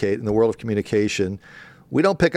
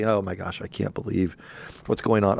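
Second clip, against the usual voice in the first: mock embarrassed hush; 7 semitones lower, 6 dB quieter than usual. Owner faking embarrassment to the press, softer and lower. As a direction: lower and softer, fake dismay. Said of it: "they're gonna say outwardly".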